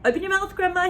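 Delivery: put-on urgent boy cry. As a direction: urge her to open up. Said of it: "he cried".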